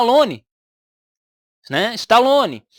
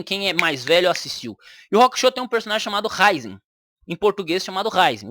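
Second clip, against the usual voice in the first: ordinary talk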